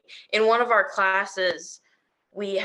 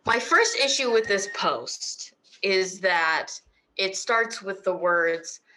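ordinary talk

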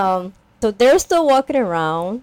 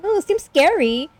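imitated scared daughter; 10 semitones higher than usual. Voicing scared crying daughter.

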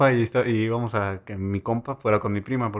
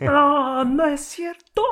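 much higher shocked disbelief; 13 semitones higher, much slower than usual. Real, not acted. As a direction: gasp it in disbelief. Reacting to gossip headline.

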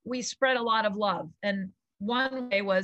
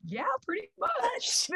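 much higher, slow, playfully cocky; mock smug agreement; 13 semitones higher, slower than usual. Answering her husband's teasing, high smug drawl. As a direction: drawl it out like you're owning it. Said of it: "And I was like".

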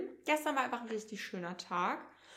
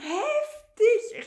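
high, loud burst of amazement; amazed reaction; 11 semitones higher, 9 dB louder than usual. Retold or imitated. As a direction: re-enact being blown away. Own amazed reaction re-enacted. (laut und hoch gestaunt, nachgespielt)

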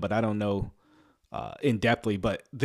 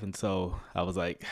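usual speaking voice

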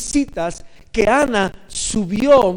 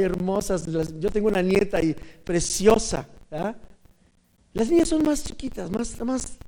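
normal talking voice

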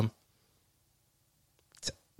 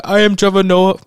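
put-on loud, higher, overdone African accent; mock an overly African accent, announcing yourself; hammed-up African accent parody; Trevor Noah; 6 semitones higher, 7 dB louder than usual. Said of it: "I'm talking like".

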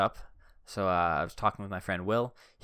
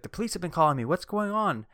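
high, louder imitation of a panicking mom; worried mom; 10 semitones higher, 4 dB louder than usual. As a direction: play the alarmed mother, pitched well up. Mom voiced, alarmed on phone.

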